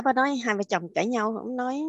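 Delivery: ordinary talk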